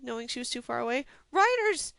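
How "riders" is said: high, loud warning shout; shouting a warning at the barracks; urgent yell. A narrator's reading. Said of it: "she yelled".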